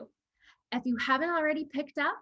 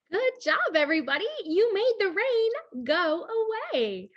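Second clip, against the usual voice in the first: excited praise; 7 semitones higher, 3 dB louder than usual. Acted. Praising children.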